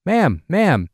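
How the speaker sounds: calling out after someone walking away; a man calling after Amy, shouting. Retold or imitated. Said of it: "another man was shouting".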